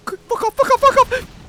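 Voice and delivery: high, loud panicked yelling; panicked scream. Real, not acted. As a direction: scream it at the screen. Screaming at game danger.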